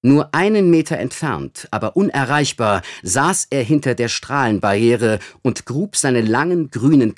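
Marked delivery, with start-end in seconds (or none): none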